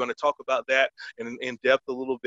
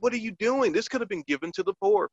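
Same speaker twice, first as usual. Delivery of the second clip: mock outraged Judas, much higher; Judas freaking out; 8 semitones higher than usual. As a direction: play Judas indignant over the wasted perfume. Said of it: "Judas freaks out".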